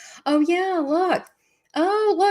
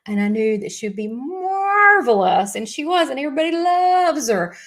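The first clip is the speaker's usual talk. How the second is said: gushing praise; 5 semitones higher, 6 dB louder than usual. Real, not acted. Host raving to viewers about her last guest, gushing. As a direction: rave with big swoops up on the key words.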